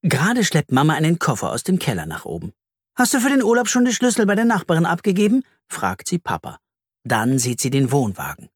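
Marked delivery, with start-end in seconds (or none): 3.0-5.4 higher, fast, hurried mother asking; mother questioning father in a hurry; busy mother. A narrator's reading. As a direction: rush the question like a busy mum. Mama's voice in story.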